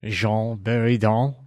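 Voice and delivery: "Jean Buridan" slowly and loudly in an attempted French accent; questioning, drawn-out copy of a French pronunciation; imitated French accent. Host tries copying co-host's French name, loud attempted French accent. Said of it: "with a French accent".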